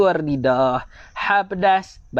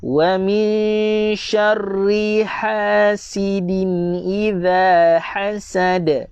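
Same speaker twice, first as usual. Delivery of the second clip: slow, higher, drawn-out tajwid recitation; Quran recitation; 3 semitones higher, much slower than usual. Shown as a demonstration. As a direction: chant each syllable slowly for students to follow. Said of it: "Sekali lagi saya baca". (bacaan tartil perlahan)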